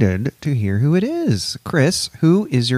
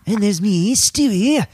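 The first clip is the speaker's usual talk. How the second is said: higher, louder Stewie impression; a bratty cartoon baby; 6 semitones higher, 3 dB louder than usual. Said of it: "ready to do a voice".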